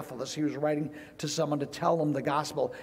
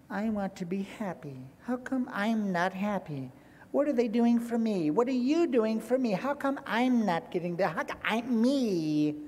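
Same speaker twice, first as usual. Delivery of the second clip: self-pitying whine; 5 semitones higher than usual. Acted. Preacher mocking people who make it all about them, self-pitying whine.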